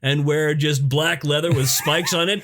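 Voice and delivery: lightly put-on Nordic accent, laughing at the end; a hint of a Scandinavian accent, building to a laughing shout; mock Scandinavian accent. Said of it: "that sounded like a fucking South African accent".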